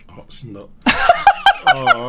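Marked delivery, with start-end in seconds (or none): 0.7-2.1 high, loud burst of laughter; laughing in disbelief. Real, not acted. Guest laughing when Takashi drops off. Reacting to dropped call.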